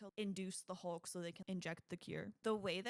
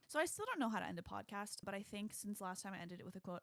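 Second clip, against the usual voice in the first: ordinary talk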